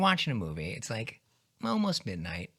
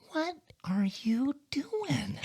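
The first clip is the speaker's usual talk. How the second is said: very slow, high, softened disbelief; dad impression; 11 semitones higher, 5 dB quieter, much slower than usual. Son plays his dad finding him up late, slow high incredulity. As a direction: stretch each word high, half whispered. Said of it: "he looks at me and goes".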